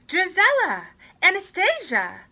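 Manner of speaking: loud, high calling out. Acted. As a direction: shout both names up through the house. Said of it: "cried the stepmother".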